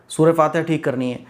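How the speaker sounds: usual speaking voice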